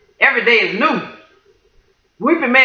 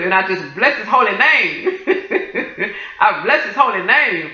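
joyful laughing praise. Real, not acted. Blessing his holy name, laughing with joy. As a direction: praise breaking into real laughter.

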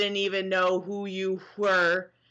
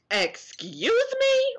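indignant excuse me; slower than usual. Real, not acted. Indignant at hater comment.

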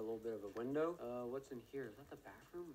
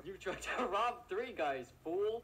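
laughing; 5 semitones higher, 5 dB louder, slower than usual. Acted. Confronting the suspect, laughing.

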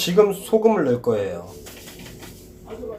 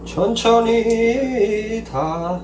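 very slow, drawn out; 5 semitones higher, much slower than usual. Real, not acted. Carefully draining corn.

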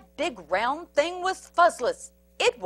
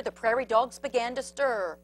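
usual speaking voice

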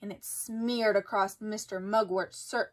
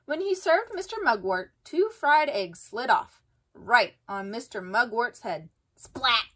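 plain reading voice

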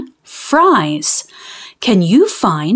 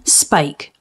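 usual speaking voice

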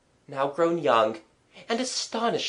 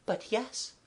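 soft, higher governess voice; 8 semitones higher, 8 dB quieter than usual. A narrator's reading. Governess answering the bailiff about their friendship, softly. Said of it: "Mademoiselle said softly".

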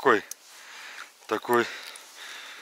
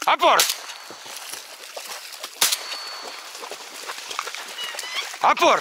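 sharp high command to dog; 5 dB quieter, faster than usual. Real, not acted. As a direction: call the dog to fetch.

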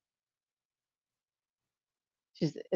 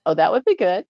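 ordinary talk

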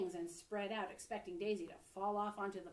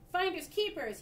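gleeful high loud exclaim; 9 semitones higher, 11 dB louder than usual. A narrator's reading. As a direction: crow over the find, loud and high. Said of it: "he exclaimed".

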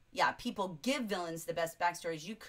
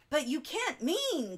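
higher, slow gasp of shock; gasped disbelief; 7 semitones higher, 3 dB louder, slower than usual. A narrator's reading. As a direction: read it as a stunned, drawn-out protest. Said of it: "gasped Rainsford".

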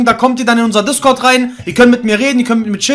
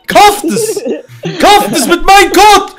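hyped ad pitch; 8 semitones higher, 4 dB louder than usual. Acted. Hyping his creator code.

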